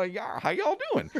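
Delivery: high-pitched mock Southern greeting; joking, high Southern twang; Southern drawl. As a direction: put on a folksy Southern accent. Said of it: "maybe there's a southern, southern part".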